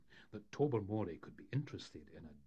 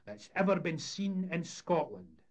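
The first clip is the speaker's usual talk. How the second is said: high, loud fairground patter; showman's announcement; 10 semitones higher, 8 dB louder than usual. A narrator's reading. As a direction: proclaim the attraction to a paying crowd. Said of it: "Sonny Jim announced".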